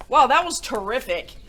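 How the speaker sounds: plain reading voice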